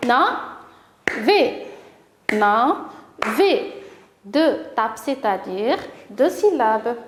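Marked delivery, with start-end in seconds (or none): 0.1-3.7 "na, ve, na, ve" as separate beats; a pause after each of "na" and "ve"; teacher tapping out "na, ve" beat by beat; split into syllables. Shown as a demonstration. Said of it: "écoutez bien".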